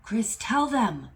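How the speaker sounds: loud, high, urgent exhortation as God; impassioned shout, insisting on every word; screaming command. Retold or imitated. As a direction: cry it out like a divine order. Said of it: "God is, like, screaming".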